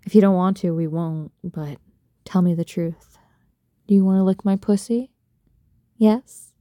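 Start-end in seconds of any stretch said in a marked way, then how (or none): none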